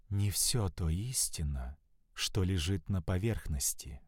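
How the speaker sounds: slow, soft, sultry murmur in his ear; languid whisper. A narrator's reading. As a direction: breathe it slowly and seductively. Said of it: "прошептала томно, не спеша".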